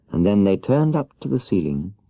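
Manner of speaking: plain reading voice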